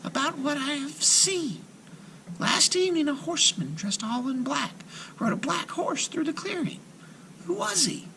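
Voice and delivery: put-on high, soft timid girl; a shy girl asking quietly; little girl voice. A narrator's reading.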